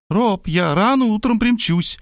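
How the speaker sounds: put-on high, chirpy young girl voice; bubbly sorority girl. A narrator's reading. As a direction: bounce it like an excited teen. Varvara girl voice.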